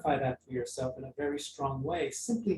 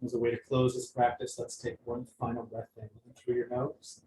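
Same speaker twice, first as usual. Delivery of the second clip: guided meditation; 4 semitones lower, 4 dB quieter than usual. Acted. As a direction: gently guide them back to the room.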